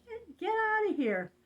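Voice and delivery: high mock yell of "Get, get out of here"; husband's yell. Retelling her husband chasing the knife man off, high yell. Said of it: "My husband yells at him".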